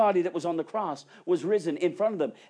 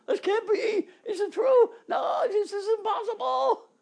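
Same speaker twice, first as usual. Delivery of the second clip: very high, loud, laughing shout; joyful disbelief; 7 dB louder than usual. Acted. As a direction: shout it laughing, overwhelmed with joy. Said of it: "did not believe for joy".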